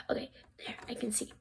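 hushed whisper. Pretend. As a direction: whisper so nobody hears. Quiet in class.

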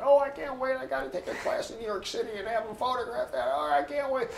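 high-pitched, gushing mock eagerness; eager mimicry. Imitating an eager freshman longing for New York, high, rushed mock excitement. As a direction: quote him in a rapid, squeaky rush of longing.

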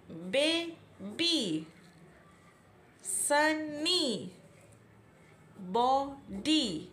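बेबी, सनी, बॉडी each said very slowly in two parts, ending in long ई; two-part words. Shown as a demonstration. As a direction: break each word in the middle. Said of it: "टू short में read कर रहे हैं". (हर शब्द को बीच में तोड़कर, धीरे-धीरे)

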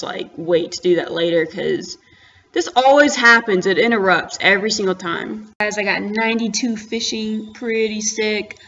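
normal talking voice

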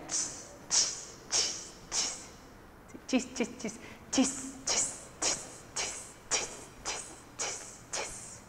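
soft unvoiced sibilant crashes; cymbal imitation. Shown as a demonstration. Teacher shows pupils the cymbals, imitating their crash. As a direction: let each "chis" ring like clashed cymbals. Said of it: "son los platillos".

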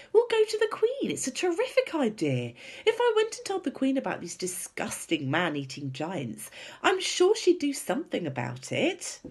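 high, eager rush of a plan; high-pitched girlish enthusiasm; excited. A narrator's reading. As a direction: bubble over with your great idea. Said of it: "in a voice filled with excitement".

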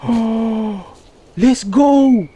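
high, loud gasping cheer; excited gasp. Real, not acted. Gets new gear in game.

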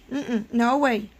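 ordinary talk

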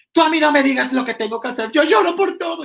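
high, loud whiny complaint; high shrill-free mock sob-yell of a crybaby. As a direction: mimic her crying and snapping back. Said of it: "se puso a llorar".

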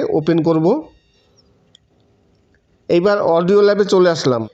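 normal talking voice

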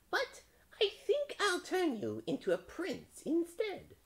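very high, slow witch. A narrator's reading. Witch deciding to transform the frog, high witchy voice. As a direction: squeal it slowly like a storybook witch.

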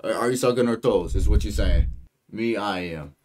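normal talking voice

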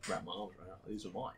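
soft, hushed clinging murmur; mock possessive murmur. Clutching his recovered scrapers, soft possessive murmur. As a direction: murmur it like hugging a treasure.

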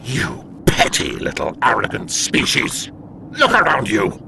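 harsh, indignant outburst; angry rebuke. Acted. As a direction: spit out the reproach in outrage. Demon berates the captive.

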